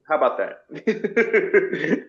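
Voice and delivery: a slow, sarcastic taunt; drawn-out sarcasm. Real, not acted. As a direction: stretch it out, daring them to argue.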